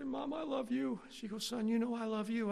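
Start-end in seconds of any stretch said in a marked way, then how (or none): 0.0-1.0 put-on high, whimpering kid voice; mock tearful affection. Boy to his mom after crying, tearful affection. 1.5-2.5 high, emotional mom voice; mock tearful tenderness. Mom to son after punishment, tearful tenderness.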